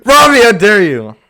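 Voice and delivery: loud, high mock scolding; mock outrage. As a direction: gasp it out in fake shock.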